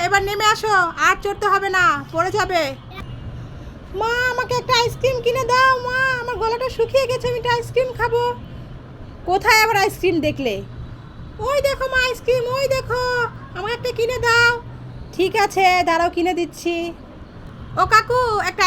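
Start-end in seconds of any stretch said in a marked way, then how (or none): 4.0-8.2 put-on higher, childlike begging voice; pleading little girl. Mother voicing her daughter begging for a horse-cart ride, in a higher childlike plea. 11.5-14.5 put-on higher, childlike begging voice; pleading little girl. Mother voicing her daughter begging for a horse-cart ride, in a higher childlike plea.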